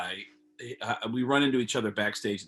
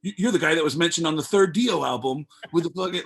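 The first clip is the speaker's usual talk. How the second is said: excited nerd fanboy; 6 semitones higher, 4 dB louder than usual. Retold or imitated. Fan greeting a music insider.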